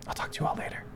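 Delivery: soft whispered aside; a soft whispered farewell to the group; whispered goodbye. Acted. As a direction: whisper it as you slip away. Cleric leaving the party quietly.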